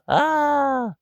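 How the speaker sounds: a put-on squeaky scream; high cartoon scream. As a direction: scream as the blow lands. Cartoon character hit.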